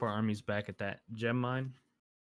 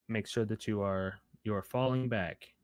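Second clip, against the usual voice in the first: ordinary delivery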